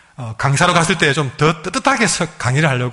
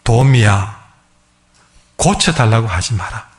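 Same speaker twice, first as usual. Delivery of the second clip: voice of God; 4 semitones lower, slower than usual. Retold or imitated. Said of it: "이런 음성이 하나 들리더랍니다".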